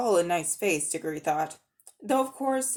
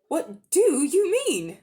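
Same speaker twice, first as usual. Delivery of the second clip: put-on higher, slow, worried boy's voice; a boy asking anxiously, pitched up; young boy Diggory; 9 semitones higher, 6 dB louder, slower than usual. A narrator's reading.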